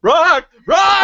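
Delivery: high, loud yelling Rock; excited shout. Real, not acted. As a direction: shout it out in delight.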